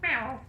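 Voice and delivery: high, loud mock meow; imitated meow. Streamer mimics her cat with a high meow. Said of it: "That's what you sound like".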